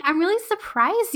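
mildly patronizing mock sweetness; Tora, sweetly condescending. Fan riffing on the ace pilot's boast, mildly sweetly condescending. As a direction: lightly imitate a sweet, superior show-off. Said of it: "sweetly condescending".

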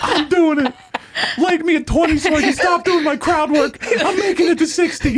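high, laughing desperate whine; mock crying comic. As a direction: plead through laughter, voice pitched high. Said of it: "You're crying like".

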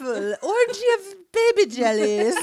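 high, loud mock schoolmarm; laughing, hammed-up schoolmarm trill; old school marm. Host teasing a friend's letter, mock old schoolmarm voice. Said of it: "like an old school marm".

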